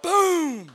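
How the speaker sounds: high yelled splash noise; loud splash boom. Retold or imitated. Speaker mimics Matt's belly flop hitting the water, loud boom. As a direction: make the big belly flop land.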